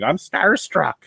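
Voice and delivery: squeaky starstruck fan voice, way up high; high mock fangirl. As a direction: gush it like a starstruck girl. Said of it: "a girl following me around the entire time going".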